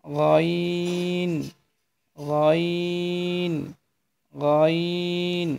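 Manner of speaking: teacher modelling গইন slowly; slow letter demo. Shown as a demonstration. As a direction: stretch গইন slowly for learners. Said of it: "এরপরে হরফটি".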